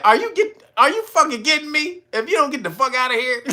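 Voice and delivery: high, fast imitated outburst; mock angry rant. Convict telling the officer off, imitated angry outburst. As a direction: act out his furious rant. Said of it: "And he said".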